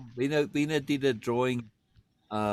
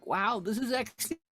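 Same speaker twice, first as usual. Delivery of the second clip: amazed; 11 semitones higher than usual. Retold or imitated. Retelling amazement at Southern accent.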